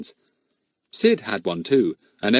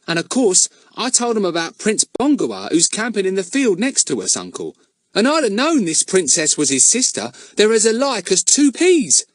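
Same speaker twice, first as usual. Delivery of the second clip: put-on eager young Ern; 10 semitones higher, 4 dB louder than usual. A narrator's reading. Ern voiced at length.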